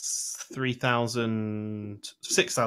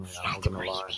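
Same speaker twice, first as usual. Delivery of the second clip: whispered; 5 semitones lower, 5 dB quieter, faster than usual. Real, not acted. Teasing confession, hushed.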